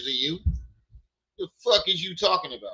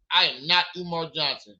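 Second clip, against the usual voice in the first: normal talking voice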